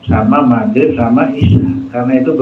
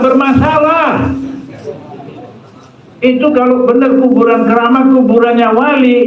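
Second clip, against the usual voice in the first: raised, insistent preacher's voice; fiery preaching; 6 semitones higher than usual. Acted. Preacher warning the congregation against idolatry, fiery and raised. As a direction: drive the warning home to the congregation.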